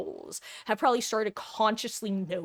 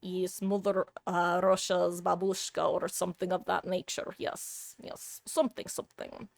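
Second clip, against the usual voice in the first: lightly put-on Russian accent, trailing off to a whisper; a hint of a bad Russian accent, fading out; self-mocking Russian accent, half-whispered; 4 dB quieter than usual. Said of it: "my Russian accent".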